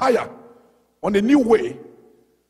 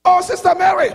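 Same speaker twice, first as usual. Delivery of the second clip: high, loud call across the street; 8 semitones higher, 6 dB louder than usual. Retold or imitated. Pastor calling out to Sister Mary as she passes, loud. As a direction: hail her from a distance.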